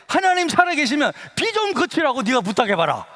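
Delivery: high-pitched mock dare; taunting challenge. Classmate challenging a believer to stop the rain, taunting. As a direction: throw down the dare like a scornful schoolmate.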